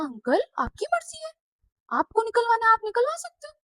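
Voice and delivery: sarcastic shrug. Retold or imitated. Girl mocking the uncle's threat to expel his kids, sarcastic. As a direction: sweetly dare him to go ahead.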